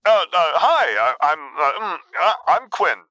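high, halting, flustered introduction; nervous stammer. A narrator's reading. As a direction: stammer through your own introduction. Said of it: "Quinn managed to stammer out in an approximation of an introduction".

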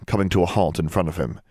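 ordinary delivery, nothing marked

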